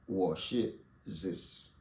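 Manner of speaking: slow and clear; slow model phrase. Shown as a demonstration. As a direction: model it slowly for the class. (lentement et clairement)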